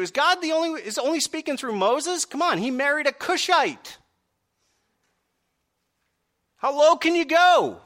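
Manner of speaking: high, loud mock outrage of Miriam and Aaron; indignant grumbler. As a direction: whine it like a scandalised gossip. Voicing Miriam and Aaron's complaint.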